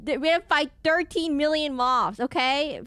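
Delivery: high, loud mock fury at the moths; overacted moth rant. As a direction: ham up a furious rant about moths. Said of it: "I just overacting".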